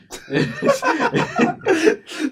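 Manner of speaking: long high shrieking laugh; laughing hard at his own line; high squealing laugh. Real, not acted. Big laugh after joke.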